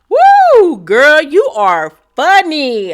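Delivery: a shrieking whoop, then slow and loud; mocking laugh. [name] mocking Britt's comeback, a loud whooping laugh. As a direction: laugh in her face.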